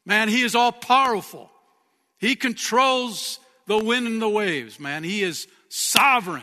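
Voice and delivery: preacher praising God's might; preaching at full force. Real, not acted. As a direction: thunder it to the church.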